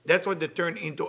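usual speaking voice

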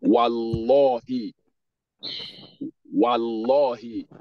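Wallahi drawn out as "wal-LAW-hi"; slow Wallahi with a rounded, heavy middle; heavy Lam. Shown as a demonstration. Teacher modelling Lam after Fatha, heavy Lam. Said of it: "Anytime Dhamma or Fatha is coming".

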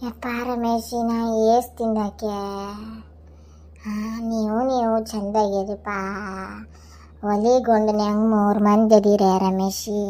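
an aged father gently reasoning; old father. Acted. Old father figure advising. (ವಯಸ್ಸಾದ ತಂದೆಯ ಧ್ವನಿ)

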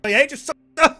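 sputtering rage. Real, not acted.

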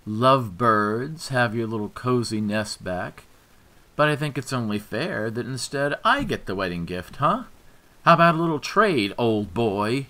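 high, loud sneering mockery; drunk taunting rival. A narrator's reading. Drunk ex mocking the lovebirds, high sneering voice. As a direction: needle them with a sneer.

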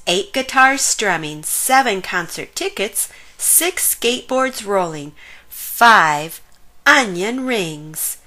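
ordinary delivery

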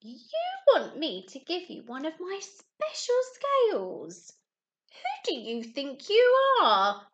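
loud, scornful outburst, slowing at the end; indignant cry. Acted. Rainbow fish scorning the little blue fish, loud indignant cry. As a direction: put the little fish in his place. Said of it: "cried the rainbow fish".

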